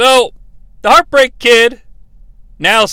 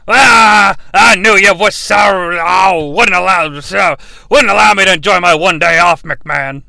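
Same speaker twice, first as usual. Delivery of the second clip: put-on loud, slurred drunken whine; drunk wrestler; 4 dB louder than usual. Wrestler griping at McMahon about his day off, drunken slur. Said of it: "He's under the influence".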